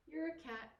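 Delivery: ordinary talk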